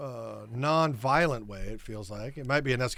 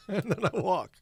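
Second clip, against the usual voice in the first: laughing; 5 semitones higher than usual. Real, not acted. Laughing at own bit.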